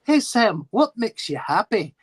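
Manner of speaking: put-on cheerful dad asking his little boy; a hint of a father's coaxing voice; imitating the father. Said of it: "his father always asks him".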